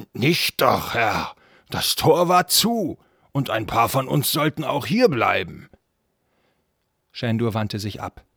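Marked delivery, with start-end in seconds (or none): 0.1-5.7 loud put-on guard voice; defensive castle guard. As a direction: report loudly, excusing yourself to your master. (lauter Wachmann, der sich rechtfertigt)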